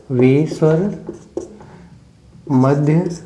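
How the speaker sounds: measured phrases with long pauses; dictation. Real, not acted. Teacher dictating middle-vowel definition, slowly in chunks. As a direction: dictate in small chunks, waiting between them.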